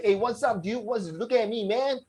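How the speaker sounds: high, casual American dude voice. Retold or imitated. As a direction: play a laid-back American guy. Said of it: "you say".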